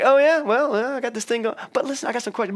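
high, quick, flustered change of subject; mock flustered woman deflecting; flustered eager. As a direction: scramble to dodge the topic with bright eagerness. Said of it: "And she's like".

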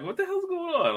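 very high mock-bewildered question; retold squeaky disbelief; bewildered alarm. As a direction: go right up high, baffled. Acts bewildered reply to mother.

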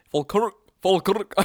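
attempted Scottish pronunciation; put-on Scots accent. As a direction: try the word in a thick Scottish accent. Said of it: "hard to say in a Scottish accent".

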